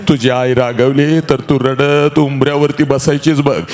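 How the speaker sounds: ordinary delivery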